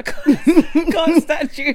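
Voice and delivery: high-pitched laugh breaking through the words; cracking up while protesting. Real, not acted. Friend jokes the inventor stole it.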